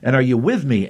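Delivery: ordinary talk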